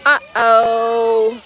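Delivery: a light singsong warning to a little one; sing-song uh-oh. Real, not acted. Mom singsongs to her toddler at the splash pad.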